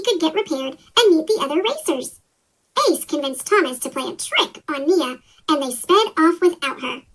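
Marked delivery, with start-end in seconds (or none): none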